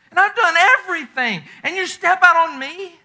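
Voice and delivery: a husband crying out at his wife's betrayal; hurt outcry. Acted. God as wounded husband pleading.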